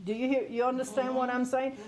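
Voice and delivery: usual speaking voice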